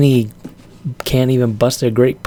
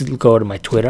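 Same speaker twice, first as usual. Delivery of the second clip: ordinary talk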